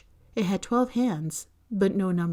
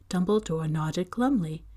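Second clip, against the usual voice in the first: usual speaking voice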